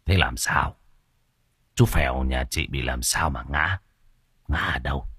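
a village healer demanding what happened; low anxious questioning; master Khoan. A narrator's reading. Narrator voices master Khoan.

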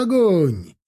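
high burst of a firing order; yelled command. A narrator's reading. Officer ordering the volley, yelled command. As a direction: let the order fly. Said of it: "проорал Гончаров".

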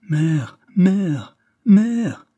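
appel crié, de plus en plus aigu et fort; cri désespéré. Acted. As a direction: crier « mère » en panique, la voix montant. Filles appelant leur mère terrifiées. (cri paniqué des filles vers leur mère)

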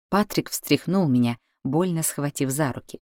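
usual speaking voice

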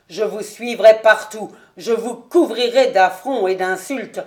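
loud, relentless tirade; furious threat. Acted. Colonel vowing to shame Ravenswood's name, furious and loud. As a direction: swear to hound him everywhere.

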